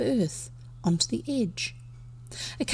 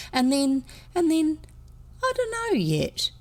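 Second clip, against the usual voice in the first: rising higher as the plan runs out; uncertain; 8 semitones higher than usual. A narrator's reading. Boy explaining a half-formed plan, uncertain. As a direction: falter upward, lost for the next step. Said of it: "ended uncertainly".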